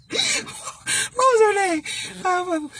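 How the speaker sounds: high, slow, searching question; puzzled drawn-out question. Real, not acted. High and slow, puzzling over the actress's name to viewers. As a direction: stretch it out while you rack your brain.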